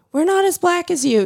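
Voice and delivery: put-on high, light kid voice; lightly voiced child; imitating the kids. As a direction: speak up like a protective child. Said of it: "they were like".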